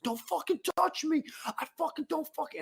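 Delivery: high panicked mock shout; unhinged sick guy. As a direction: shriek it like a man losing it. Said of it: "the guy who was shitting and throwing up's like".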